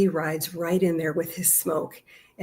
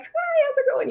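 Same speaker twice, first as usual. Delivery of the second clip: much higher, sing-song hello to a dog; dog greeting voice. Retold or imitated. Greeting a stranger's dog on the path, high sing-song. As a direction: chirp a hello at a passing dog. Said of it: "you greet the dog, like".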